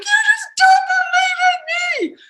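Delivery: high, loud shouted accusation. Retold or imitated. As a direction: yell it at him, hurt. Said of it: "I'd be like".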